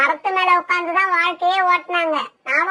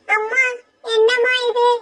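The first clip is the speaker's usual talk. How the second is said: put-on high little girl's voice breaking into tears; a small daughter pleading, crying at the shut door; higher child voice, sobbing by the last line; 5 semitones higher than usual. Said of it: "beauty எப்படி அழுகுறான்னு".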